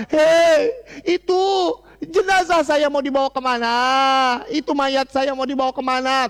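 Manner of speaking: high frantic cry of a wicked corpse; a dead man's panicked wail, where are you taking me; high alarmed shout asking where his body is going; screaming corpse. Acted. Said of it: "dia juga teriak-teriak".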